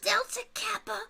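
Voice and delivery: high, hesitant goblin voice; reluctantly name them; high timid goblin answer. Acted. Hobgoblin names the culprit.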